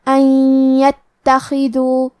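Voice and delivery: ان یتخذو very slow, nun merged into a doubled nasal ی; slow idgham demo on ان یتخذو; idgham with ghunna. Shown as a demonstration. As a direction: blend ان into یتخذو with a held nasal. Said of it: "غنہ اور تشدید کے ساتھ ادا کریں گے".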